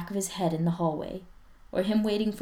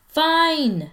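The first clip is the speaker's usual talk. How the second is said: high, loud sulky call; loud grudging call; calling back; 11 semitones higher, 10 dB louder than usual. Acted. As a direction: shout it back across the house. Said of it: "Spencer calls back".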